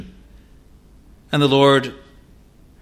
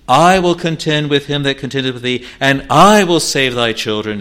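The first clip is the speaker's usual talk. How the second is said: louder proclamation, "I" pressed; emphatic preaching; 4 semitones higher, 8 dB louder than usual. Acted. Preacher proclaiming God's promise to the parents, louder. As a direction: declare the promise with force.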